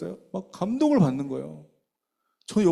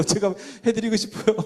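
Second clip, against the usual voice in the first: laughing; 7 semitones higher, 3 dB louder than usual. Retold or imitated. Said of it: "막 이랬거든요".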